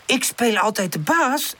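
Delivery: high, affronted question; offended. A narrator's reading. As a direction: echo the accusation, insulted. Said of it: "herhaalt Pip beledigd".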